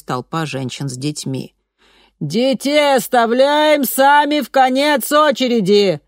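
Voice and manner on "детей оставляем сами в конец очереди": high, loud, slow command; commanding megaphone order. A narrator's reading. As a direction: bark the order at the crowd. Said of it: "приказала женщина".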